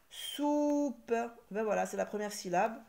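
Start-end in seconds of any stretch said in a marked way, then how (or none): 0.1-1.1 "soupe" held high and long; slow, lifted sounding-out of "soupe"; drawn-out word. Shown as a demonstration. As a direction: stretch the vowel of "soupe" at high pitch.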